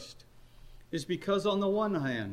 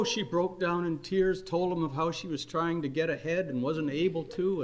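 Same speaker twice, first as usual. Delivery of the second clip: ordinary delivery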